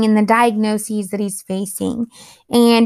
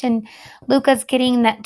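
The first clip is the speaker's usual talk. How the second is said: usual speaking voice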